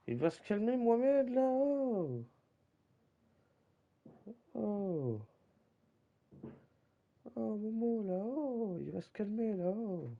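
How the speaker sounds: high, singsong teasing; teasing a friend with a high mock-soothing voice. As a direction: coo at him like calming a child.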